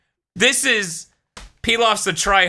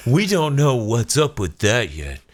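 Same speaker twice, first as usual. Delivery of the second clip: slow mocking imitation of S1; 5 semitones lower than usual. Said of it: "mocking S1's words again".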